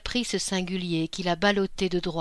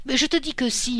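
higher, louder insistence on te; quarrel shout; 5 semitones higher, 3 dB louder than usual. A narrator's reading. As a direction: shout back in the squabble. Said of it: "On criait". (dispute criée, insistance sur « te »)

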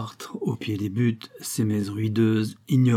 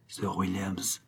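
low, hushed name, much softer; 2 semitones lower, 8 dB quieter, slower than usual. A narrator's reading. Worker leans to Venture's ear, hushed and low. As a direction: breathe the name close to his ear. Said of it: "dit-il tout bas".

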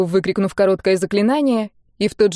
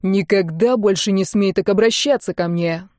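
ordinary delivery

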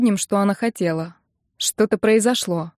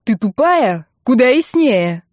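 louder, higher sibling's retort; exasperated yelling; 3 semitones higher, 5 dB louder, slower than usual. A narrator's reading. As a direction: snap at a slow sister. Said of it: "заорал Серёжа". (раздражённо орёт)

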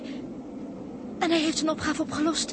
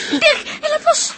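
higher, louder scream of fear; panicked cry; 10 semitones higher, 9 dB louder than usual. Acted. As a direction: shriek as he slips. Climber is losing grip. (angstige gil)

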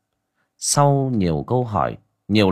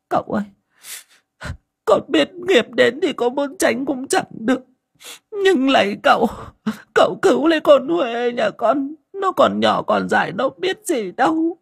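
tearful pleading. A narrator's reading. A mother begs the shaman to save her daughter, sobbing. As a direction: plead for the child's life, voice crying. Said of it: "mếu máo khóc".

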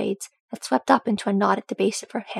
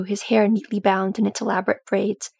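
plain reading voice

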